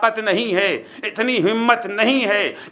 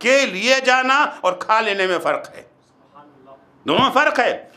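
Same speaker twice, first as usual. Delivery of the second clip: ordinary talk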